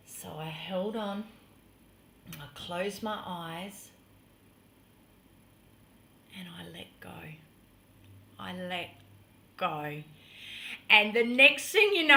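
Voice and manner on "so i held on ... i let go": very soft, low, long pauses; near-whispered, held-breath storytelling; hushed suspense. Real, not acted. Suspense before letting go.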